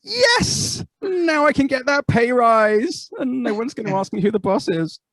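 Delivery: very high, slow triumphant squeal; mock woman in a man's body, thrilled at the pay rise; mock delight. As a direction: crow it out in hammed-up glee. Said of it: "we'd be like".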